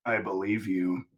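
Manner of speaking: lightly voiced, calm Batman; a hint of a stoic hero; understated Batman. Batman answers the threatening mutant, quietly stoic.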